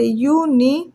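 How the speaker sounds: "यूनी-" drawn out slowly, one syllable at a time. Shown as a demonstration. Teacher spelling university in Hindi for students, syllable by syllable. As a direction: sound out "यूनी-" slowly as if writing it. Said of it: "यूनिवर्सिटी को हिंदी में कैसे लिखेंगे".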